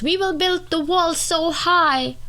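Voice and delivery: high, slow mock-proud promise; mock boastful voice. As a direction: pompously promise the wall. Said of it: "solutions that people give".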